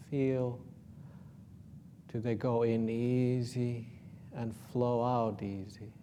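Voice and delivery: ordinary delivery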